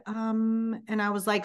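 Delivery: ordinary talk, nothing marked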